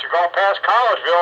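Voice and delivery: ordinary talk, nothing marked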